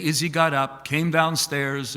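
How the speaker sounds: ordinary talk, nothing marked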